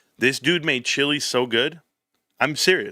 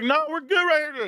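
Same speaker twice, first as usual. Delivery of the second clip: high mock voice. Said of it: "We're like".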